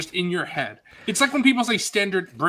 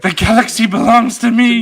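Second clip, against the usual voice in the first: villain shout; 6 semitones higher, 6 dB louder than usual. Pretend. Joking villain quote on stream.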